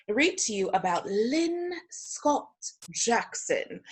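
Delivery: playful put-on British storyteller, drawn-out words; pretentious British accent. Teacher welcoming distinguished guests to story time, pretentious British accent. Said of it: "this little pretentious British accent".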